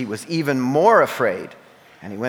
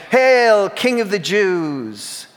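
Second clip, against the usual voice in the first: mocking hail; 7 semitones higher, 5 dB louder, much slower than usual. A narrator's reading.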